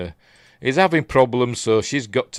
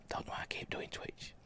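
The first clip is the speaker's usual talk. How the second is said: whispered aside; 20 dB quieter than usual. Real, not acted. Muttered aside after plug.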